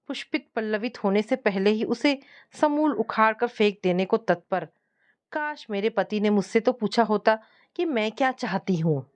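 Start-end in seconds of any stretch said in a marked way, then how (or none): none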